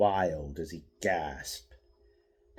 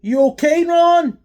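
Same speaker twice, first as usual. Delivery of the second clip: high, loud call across the alley; 15 dB louder than usual. A narrator's reading. Thug calling to fallen Ron, loud high call. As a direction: shout to check on a friend. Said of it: "said Raikou".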